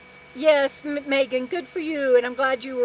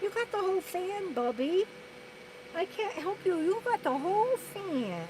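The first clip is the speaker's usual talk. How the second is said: higher, softer coo to the dog; soft pet talk; 6 semitones higher, 7 dB quieter than usual. Real, not acted. Owner cooing at her hot dog, soft high pet talk. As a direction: gently tease the dog.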